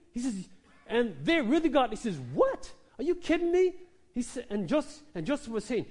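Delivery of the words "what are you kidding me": shrieking high "What?" into incredulous question; outraged disbelief. Acted. Brothers reacting to Joseph's dream, shrill outraged disbelief. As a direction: squawk in outrage at the nerve.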